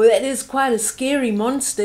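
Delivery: ordinary delivery, nothing marked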